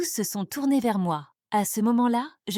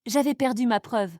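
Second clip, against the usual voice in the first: usual speaking voice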